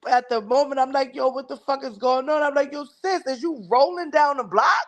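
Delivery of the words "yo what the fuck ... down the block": alarmed. Retold or imitated. Alarmed quote to rolling sister.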